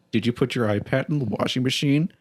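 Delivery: imitated deadpan with a slight rise at the end; level, unamused mock questioning. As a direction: ask it deadpan, imitating a partner's disbelief. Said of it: "looked at me deadpan".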